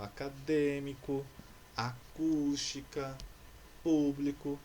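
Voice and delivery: acadêmico, acústica, público with DÊ, CÚS, PÚ drawn out; stress on antepenultimate syllable. Shown as a demonstration. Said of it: "Mesma coisa acontece com". (acadêmico, acústica, público alongando a antepenúltima sílaba)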